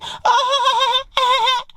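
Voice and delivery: very high-pitched imitated cackle; mock laugh. Girl laughs at his approach, high mocking laugh.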